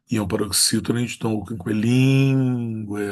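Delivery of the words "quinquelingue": Quinquelingue with a long drawn-out LIN; stress on LIN. Shown as a demonstration. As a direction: stretch the accented I in "Quinquelingue". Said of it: "acento agudo no I".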